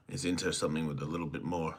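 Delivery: usual speaking voice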